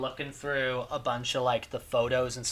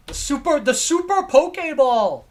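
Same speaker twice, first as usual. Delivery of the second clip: excited shout; 11 semitones higher, 9 dB louder than usual. Real, not acted. Excited about fursuit photo.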